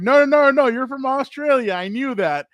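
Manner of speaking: high, loud, hurried no no no; nervous fix after offending a wrestler; panicked backpedal. Retold or imitated. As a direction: scramble to take it back. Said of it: "I'm like".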